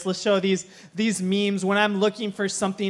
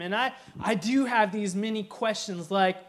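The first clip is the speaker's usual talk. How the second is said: usual speaking voice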